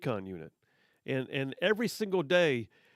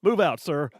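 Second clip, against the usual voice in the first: barked order; 9 dB louder than usual. Retold or imitated. Instructor dismissing to the gig pit.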